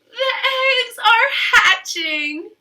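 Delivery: Piggy proudly reveals news; excited Piggy. Acted.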